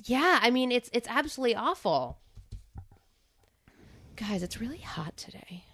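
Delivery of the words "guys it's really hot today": breathy whisper aside. Real, not acted. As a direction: whisper it to the audience.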